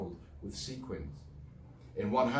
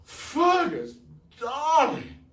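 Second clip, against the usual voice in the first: very high, loud trill; 9 dB louder, much slower than usual. Acted. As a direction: gush at the boy. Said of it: "trilled Eugenie".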